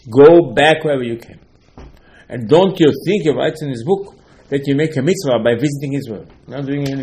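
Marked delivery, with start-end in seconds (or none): 0.1-1.4 loud, booming command sending them home; stern divine rebuke. Acted. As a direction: order them back like an offended landlord. God rebukes the tourist.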